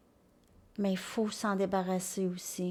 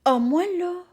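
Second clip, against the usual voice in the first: high, louder mock protest; phobic person; 8 semitones higher, 6 dB louder than usual. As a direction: act out someone announcing their phobia, pitched up. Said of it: "il y en a qui se font, ah, des phobies".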